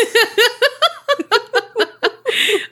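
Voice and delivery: high shrieking laughter. Real, not acted. Cohost cracks up at the keys joke, high laughter.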